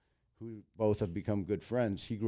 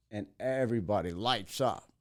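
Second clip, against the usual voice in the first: ordinary talk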